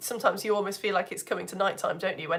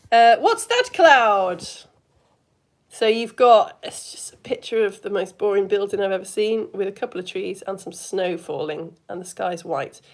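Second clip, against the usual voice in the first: usual speaking voice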